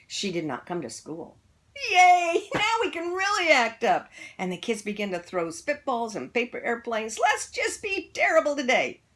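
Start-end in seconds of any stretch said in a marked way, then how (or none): 1.9-4.1 put-on high, loud kid cheer; gleeful cheer. A narrator's reading. Kids cheering their teacher is gone, high excited. 7.2-9.0 put-on high, loud mischievous kid voice; gleeful kids. A narrator's reading. Kids planning to misbehave, high and gleeful.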